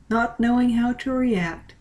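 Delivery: ordinary delivery, nothing marked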